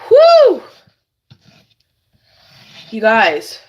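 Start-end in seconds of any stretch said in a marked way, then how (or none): 0.1-0.6 loud, high whew of relief; overwhelmed exhale, loud and high. Real, not acted. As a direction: blow out a big high whew. Reacting to strong onion fumes.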